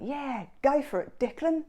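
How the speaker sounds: mock Australian cheer. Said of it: "a little Australian slip".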